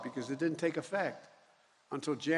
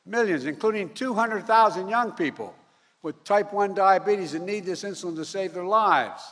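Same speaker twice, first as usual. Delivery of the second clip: rising, louder call; rally speech; 4 semitones higher, 7 dB louder than usual. Real, not acted. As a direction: build the crowd up on millions.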